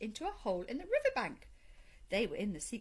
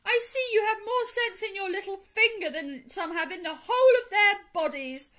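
high, loud, sustained declaration; raised voice, proclaimed with force; emphatic praise; 10 semitones higher, 11 dB louder than usual. A narrator's reading. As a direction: praise him grandly. Badger praises mole.

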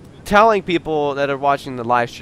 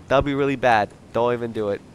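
ordinary talk

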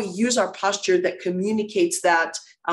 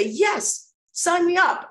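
eager agreement; 9 semitones higher, much slower than usual. Pretend. Voicing the audience's keen answer.

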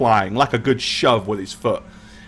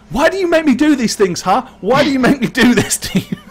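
laughing mock despair; 11 semitones higher, 5 dB louder than usual. Real, not acted.

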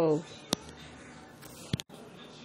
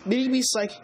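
usual speaking voice

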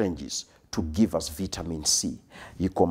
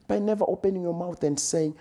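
much higher and faster, the refrain at its peak; rising climax; 10 semitones higher, faster than usual. Shown as a demonstration. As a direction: lift it high and push the pace. Repeated refrain, climax of build.

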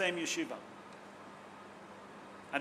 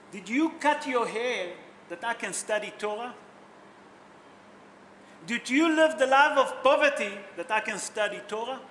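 challenging questions, louder and higher on the second; retold rebuke; 4 semitones higher, 7 dB louder than usual. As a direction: act out a husband's pointed rebuke. Retold husband rebukes his jealous wife.

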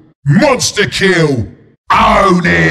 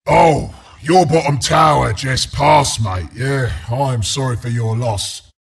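low, hushed funeral sympathy; mock condolence; 7 semitones lower than usual. Announcer consoles mate on tower's passing, mock sorrowful. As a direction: break the bad news gently, pitched down.

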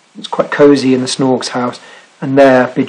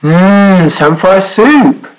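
high, slow, savouring sailor voice; high rabbit voice; 5 semitones higher, 3 dB louder, much slower than usual. Acted. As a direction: relish the smell of the soup. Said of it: "said the sailor".

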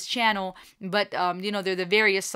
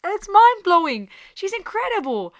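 excited; 10 semitones higher, 5 dB louder than usual. Real, not acted. Raving about singer.

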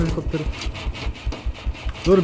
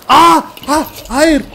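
sudden high, loud cry of alarm; startled cry; 9 semitones higher, 8 dB louder, much slower than usual. Real, not acted. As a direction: yelp as the shot hits. Plane shot down, sudden alarm. (ani korkuyla yüksek, bağıran çığlık)

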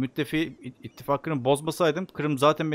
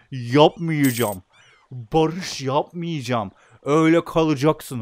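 slow spiteful refusal; slower than usual. Real, not acted. Refusing peace to spite rival.

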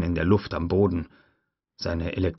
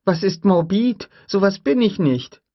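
put-on a little girl bristling; indignant girl; 13 semitones higher, 6 dB louder than usual. Lex answers her brother Tim, high indignant child voice.

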